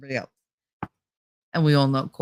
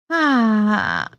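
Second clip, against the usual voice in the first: usual speaking voice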